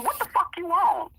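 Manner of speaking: high, street-tough confrontation. Retold or imitated.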